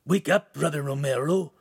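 urgent croak to rouse a sleeper; croaky wake-up call. A narrator's reading. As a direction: croak it out insistently. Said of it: "heard a croaking voice say".

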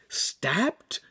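high, shocked exclamation; boy reacts to news of a stabbing, exclaiming in shock. A narrator's reading. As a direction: blurt it out in disbelief. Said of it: "Handsome exclaimed".

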